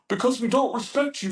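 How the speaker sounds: high mock-heroic recruit answer; imitated over-earnest trainee; clueless movie-style trainee. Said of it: "think he was in, like, a movie".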